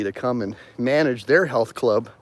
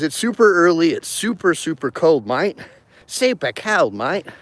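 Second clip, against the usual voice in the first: pitched-up playful Aussie "super cold, mate"; lightly mock Aussie; 5 semitones higher than usual. Vlogger complains about the cold, light mock Aussie. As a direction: keep the Aussie joke going.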